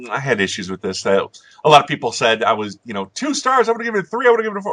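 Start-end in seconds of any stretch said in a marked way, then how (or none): 3.1-4.7 much higher-pitched mock commenter voice; mocking complainers. Reviewer quoting people who disputed the rating, mocking high voice. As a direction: parody whining objectors in a high voice.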